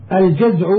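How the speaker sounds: الجزع said "al-jaz'", a after the j, ending in ayn; fatha on الجزع. Shown as a demonstration. Reader explaining Yemeni beads, الجزع with fatha, "al-jaz'". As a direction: open short a in الجزع. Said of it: "هو بفتح الجيم".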